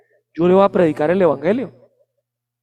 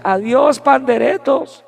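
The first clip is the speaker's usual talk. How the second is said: mocking goodbye; 6 semitones higher, slower than usual. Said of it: "La tía del segundo piso ... decía".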